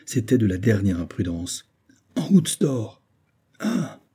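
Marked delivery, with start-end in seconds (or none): 2.2-3.0 urgent order. A narrator's reading. Urgent order to leave the fire. 3.6-4.0 exclamation. A narrator's reading. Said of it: "s'écria le sergent".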